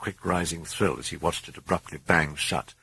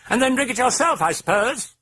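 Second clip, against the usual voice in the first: high, mocking British accent; cheeky British voice; 5 dB louder than usual. A narrator's reading. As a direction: tease the priest. British voice mocks.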